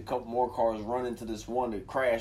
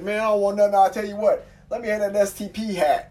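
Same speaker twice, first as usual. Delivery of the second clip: higher, louder stranger asking for the hat; a hint of the stranger; lightly voiced stranger; 9 semitones higher, 7 dB louder than usual. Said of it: "He said".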